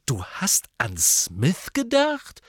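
slow, incredulous, rising question; indignant. A narrator's reading. Harry appalled at Hermine considering Smith, indignant. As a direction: draw it out in disbelief. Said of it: "sagte Harry empört".

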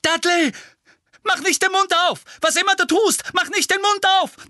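high, loud desperate warning; urgent shout. A narrator's reading. Harry warns Dudley to keep his mouth shut.

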